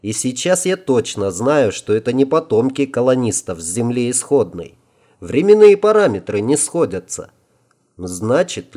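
plain reading voice

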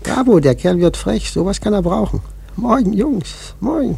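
high loud gleeful jeer; loud mocking cry. Prophet loudly jeering at the rude sailor. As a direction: crow with delight at the insult.

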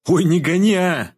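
high-pitched dismissive retort; brushing off mockery. A narrator's reading. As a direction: wave the taunt away. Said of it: "отмахнулся я от его издёвки". (отмахиваясь от издёвки)

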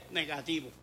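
low, soft "Negativo"; hushed. Retold or imitated. Telling the church the test result, a low hush. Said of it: "Voy y me chequeo".

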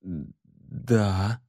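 squeezed out. Acted. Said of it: "Ларри выдавил".

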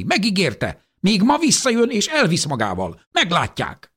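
high, loud, rising defiant cries; furious old woman insisting she'll be fetched; angry shout. Acted. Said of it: "kiáltotta".

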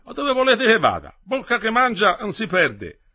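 high, loud offhand voice; dismissive station man. Acted. Station staff refusing to alert anyone, loud and dismissive. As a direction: brush off the worry with a proverb.

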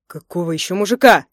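loud, angry challenge; barked question. A narrator's reading. Husband challenging wife's threat, angry bark. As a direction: bark it in disbelief. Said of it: "рявкнул Лебедев".

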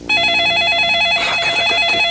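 yelled swearing in frustration; furious shriek of a curse; shouted curse. Acted. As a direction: scream the curse out. Cursing alone after call.

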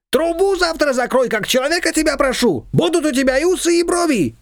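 high, brushing-off neighbour; dismissive Shushana. A narrator's reading. Shushana brushing off the confectioner, high and dismissive. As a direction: wave her off, pitched high. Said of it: "отмахнулась Шушана".

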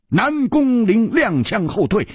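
usual speaking voice

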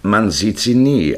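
ordinary delivery

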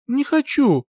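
high, whiny pouting refusal; capricious pout. Acted. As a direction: sulk like a spoiled child. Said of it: "капризно надула губы". (капризно, надув губы)

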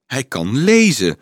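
a mild cry of objection; called out. A narrator's reading. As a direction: protest it across the group. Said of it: "riep Frida".

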